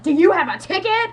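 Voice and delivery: loud, high mock demand for a ticket; mock ticket lady. Acting out the lady at door.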